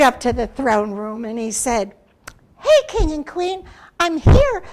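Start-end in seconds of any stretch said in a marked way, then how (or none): 2.6-4.6 loud, high announcement; bold Turtle. Acted. Turtle proudly demanding his gift from the King and Queen, loud. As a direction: announce yourself proudly to the throne.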